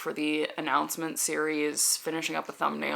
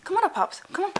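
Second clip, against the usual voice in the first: coaxing a dog; 12 semitones higher, much faster than usual. Real, not acted. Calling the dog up.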